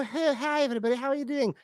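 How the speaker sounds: high, soft posh parent greeting; posh local accent. Retold or imitated. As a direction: chirp a hello in a posh village accent. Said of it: "the accents".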